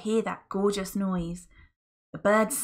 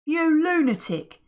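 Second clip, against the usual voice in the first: higher, loud scorn; disgusted; 9 semitones higher, 6 dB louder than usual. Acted. Mister Gum scorning Friday, loud disgust. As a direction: sneer in disgust. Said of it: "shaking his head in disgust".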